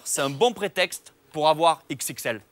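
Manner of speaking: normal talking voice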